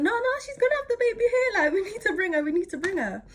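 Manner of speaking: high frantic imitation of a scared dad; panicked dad. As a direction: urge them anxiously to hurry. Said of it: "he's like".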